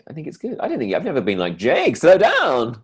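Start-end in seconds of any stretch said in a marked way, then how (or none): 1.6-2.8 high, loud, drawn-out shout; hammed-up scolding yell; mock telling-off. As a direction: call out in mock exasperation. Acting out a telling-off.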